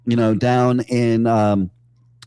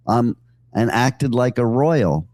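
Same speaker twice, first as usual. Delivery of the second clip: ordinary talk, nothing marked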